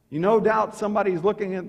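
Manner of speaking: usual speaking voice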